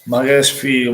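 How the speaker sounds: ordinary talk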